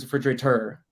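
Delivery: puzzled repeat of end-stressed "refrigerator"; refrigera-TOR, stressed at the end; misplaced stress. Retold or imitated. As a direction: echo "refrigerator" with the weight on the final TOR. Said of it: "putting the emphasis on the wrong part".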